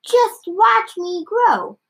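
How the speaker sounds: high, drawn-out boastful cry; grandma's shout. A narrator's reading. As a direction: stretch it out high and slow. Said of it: "she shouted".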